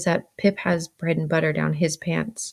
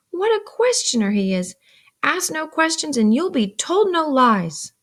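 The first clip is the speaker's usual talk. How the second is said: raised-pitch, irritated reproof; scolding; 11 semitones higher, 4 dB louder than usual. A narrator's reading. Sister rebukes Pip for asking questions, scolding. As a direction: chide a nosy child.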